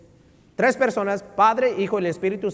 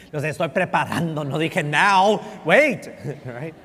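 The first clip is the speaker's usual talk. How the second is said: laughing; 4 dB louder than usual. Real, not acted. Laughs at own amen joke.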